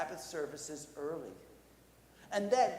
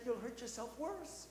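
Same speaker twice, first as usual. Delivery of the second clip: higher, softer worried warning; urgent pleading; 6 semitones higher, 5 dB quieter than usual. Acted. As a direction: warn an injured friend anxiously. Boy urges injured werewolf to keep still.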